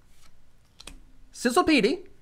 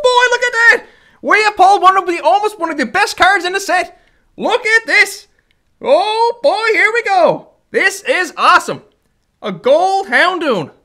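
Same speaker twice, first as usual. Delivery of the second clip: louder and higher, bursting with excitement; thrilled; 5 semitones higher, 6 dB louder than usual. Real, not acted. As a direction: show off the big pull, barely containing joy. Pulled a gold rare card.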